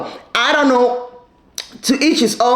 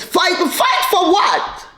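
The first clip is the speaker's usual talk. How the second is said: incredulous, higher and drawn out; exasperated; 7 semitones higher, slower than usual. Real, not acted. Host mocking the mom's advice, incredulous and high. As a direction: throw the words back in disbelief.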